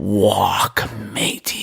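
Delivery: drawn-out, put-on pirate order; pirate command. Pirate ordering a friend onto the plank, drawn-out command. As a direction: stretch the words like a comic pirate captain. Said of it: "and you say".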